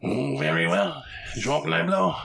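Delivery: put-on horrible French accent. Player voicing the giant for the table, in a bad French accent. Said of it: "my horrible French accent".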